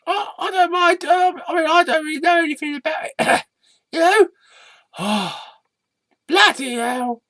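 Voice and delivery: high puppet voice at a normal level; the little puppet going shy; red sock puppet. Acted.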